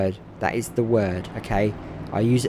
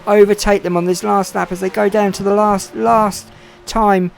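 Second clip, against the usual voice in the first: raised, driving play-by-play of the final laps; live race commentary; 4 semitones higher, 4 dB louder than usual. Acted. Commentator calling the final lap to viewers, eager. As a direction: call the race as it unfolds.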